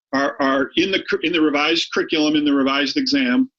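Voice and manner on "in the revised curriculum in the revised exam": ordinary talk, nothing marked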